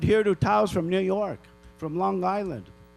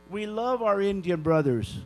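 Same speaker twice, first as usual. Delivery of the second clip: normal talking voice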